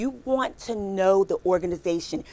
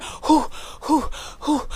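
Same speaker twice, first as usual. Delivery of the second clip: mock panting; 6 semitones higher, slower than usual. Panting while crawling.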